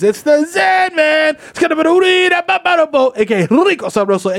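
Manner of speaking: high slow shouted intro; hype announcer. Acted. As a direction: boom it like a ring announcer.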